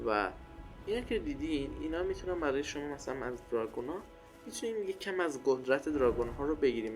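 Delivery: ordinary delivery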